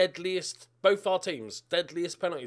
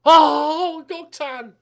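excited shout; 13 semitones higher, 15 dB louder, much slower than usual. Real, not acted. Excited reaction to player.

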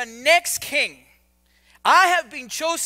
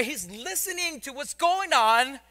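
usual speaking voice

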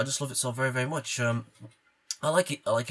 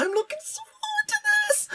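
much higher squeal of glee; excited squeal. Real, not acted. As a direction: squeal it out with eagerness. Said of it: "I'm so excited".

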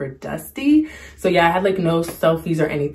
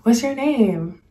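higher, slow friendly greeting, lightly voiced; imitating the actor; 6 semitones higher, much slower than usual. Lightly voiced actor asking a shy fan her name. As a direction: lean in and ask her name twice. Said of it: "he's like".

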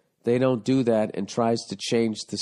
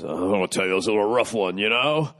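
a hint of a weary older guy, lower; lightly voiced tired old man; 4 semitones lower than usual. Host mocking the booted contestant, lightly voiced weary old man. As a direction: sigh it out like a worn-out guy. Voicing the poor bastard.

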